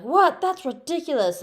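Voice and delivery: sharp high "What?" then a scoff; outraged surprise. Retold or imitated. King David hearing the parable, shocked and outraged. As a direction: jump up in pitch, shocked and indignant. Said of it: "he got all mad".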